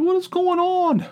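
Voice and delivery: high, indignant cry of a sore loser; exasperated disbelief. Retold or imitated. Gambler baffled by his boy's luck, exasperated. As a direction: voice shooting way up in bafflement. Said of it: "almost made me angry".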